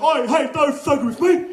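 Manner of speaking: hammed-up British hard-man accent; British gangster accent. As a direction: threaten like a British movie gangster. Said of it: "gangster British Guy Ritchie movie thing".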